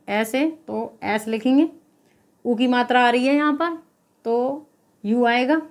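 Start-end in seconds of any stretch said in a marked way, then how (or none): none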